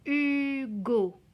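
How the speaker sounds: "Hugo" stretched for careful listening; sounded-out word. Shown as a demonstration. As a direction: call out "Hugo" slowly in two syllables. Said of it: "Écoutez bien".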